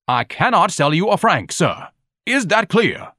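high, emphatic haughty refusal; pompous Ignatius, stern. A narrator's reading. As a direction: lay down the law pompously.

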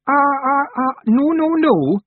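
frantic, very high loud stammer; agitated little Feegle. A narrator's reading. Rob denying the break-in, high agitated Feegle. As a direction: panicky wee man protesting. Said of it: "said Rob, jumping up and down".

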